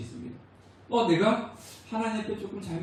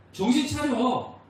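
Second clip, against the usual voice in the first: slow, stern rebuke, pitched up; a father telling a child to snap out of it; stern wake-up call; 6 semitones higher, slower than usual. Retold or imitated. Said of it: "이게 징계예요".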